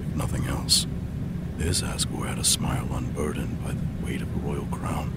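hushed whispered inner monologue. Acted. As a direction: breathe it out like a secret thought. Whispered private thoughts to himself.

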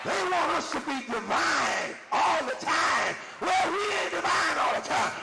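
fervent shouted preaching. Real, not acted. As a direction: drive it home to the church. Preaching climax.

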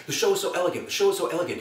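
fast, high-pitched repeated praise; Robin Williams, rapid gushing. Retold or imitated. Idol gushing to a cast member, rapid patter. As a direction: gush quickly, looping on the same phrase. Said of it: "He kept repeating this elegant thing".

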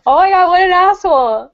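loud, high-pitched exclamation of outrage; indignant outburst. Real, not acted. Host reacting to a comic critic's snobbery, indignant outburst. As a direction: burst out in indignant disbelief.

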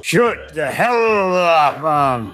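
low, drawn-out angry growl of words; seething. Acted. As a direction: drag out the order slowly and low. Angry at the gloating duo.

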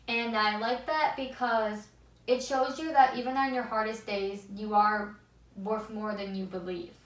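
normal talking voice

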